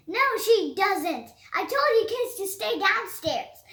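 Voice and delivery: loud, annoyed rebuke; exasperated scolding. Acted. Petey scolding kids who came downstairs, loud annoyed scold. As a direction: cut the kids off sharply.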